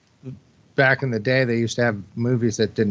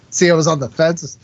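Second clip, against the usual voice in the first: usual speaking voice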